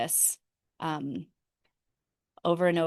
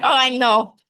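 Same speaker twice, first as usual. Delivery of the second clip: louder, higher mock Russian mom; Russian accent; 5 semitones higher, 11 dB louder than usual. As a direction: imitate an emphatic Russian mother. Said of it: "She's very Russian".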